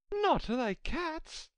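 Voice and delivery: very high indignant mouse cry, drawn out; shrill, passionate. A narrator's reading. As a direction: squeal it slowly in outraged disbelief. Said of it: "cried the mouse in a shrill, passionate voice".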